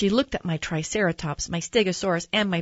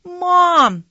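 put-on high, loud child's yell; excited yell; 13 semitones higher, 10 dB louder than usual. Boy to his mom on seeing a dinosaur, yelling high and loud. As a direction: yell up high for your mother. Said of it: "I yelled".

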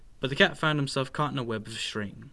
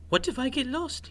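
worried mouse, much higher pitch; 11 semitones higher than usual. A narrator's reading. Squeak frets to his sister about getting lost, worried. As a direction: fret in a high little voice. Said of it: "he worried".

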